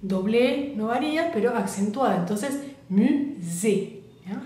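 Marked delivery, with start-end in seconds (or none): 2.9-4.0 "musée" slowly, opening wide on the final é; drawn-out French "musée", open ending. Shown as a demonstration. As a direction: model "musée" as mu-zé, mouth well open. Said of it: "Abro bien la boca".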